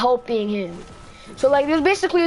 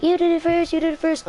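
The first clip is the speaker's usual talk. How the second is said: higher-pitched mock tattling; imitated childish accusation; 5 semitones higher than usual. Boy in principal's office blaming, high and childish. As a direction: pitch it up like a squabbling kid. Kid's childish blame, retold.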